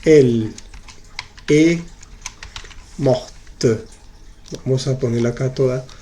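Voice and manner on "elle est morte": elle, est, morte said one word at a time with pauses; word by word model. Shown as a demonstration. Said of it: "Si fuera singular".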